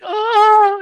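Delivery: loud, high, drawn-out mock panic "oh"; high-pitched comic cry of dread; scared beta boy. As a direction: imitate a frightened beta male's wail. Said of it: "scared beta boy".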